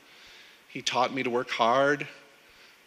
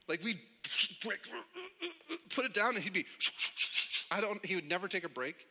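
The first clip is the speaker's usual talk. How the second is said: ordinary talk, nothing marked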